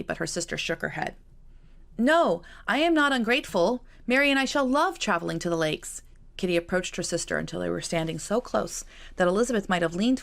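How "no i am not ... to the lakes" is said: high, louder earnest protest; eager protest. Acted. Kitty to Elizabeth, eagerly insisting she is grateful.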